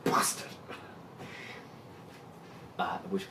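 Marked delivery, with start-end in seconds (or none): 0.0-0.5 hushed strained curse; mage cursing. Acted. DM voicing mad mage straining at spell, hushed curse. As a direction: whisper the curse through effort. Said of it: "he screws up his face and again he goes".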